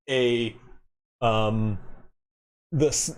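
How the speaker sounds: ordinary delivery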